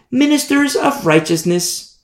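"ministers of righteousness" pitched high, as if in quotes; sarcastic quote-mark emphasis on "ministers of righteousness"; air quotes. Acted. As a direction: set "ministers of righteousness" apart with mock-lofty high pitch. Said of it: "emphasis on air quotes".

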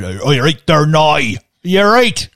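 loud, high Northern Irish impression; Northern Irish accent. Shown as a demonstration. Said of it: "I can do a Northern Irish accent".

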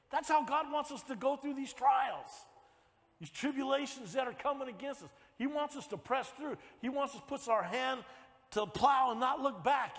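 preaching at full force. Acted. Preaching build again.

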